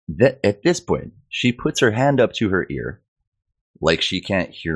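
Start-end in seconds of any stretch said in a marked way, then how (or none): none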